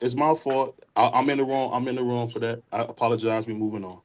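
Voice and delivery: a real apology that fades out quietly; quiet sincere apology. Real, not acted. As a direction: let the voice drop as you apologize. Apologizing after the argument.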